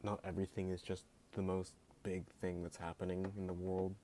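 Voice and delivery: usual speaking voice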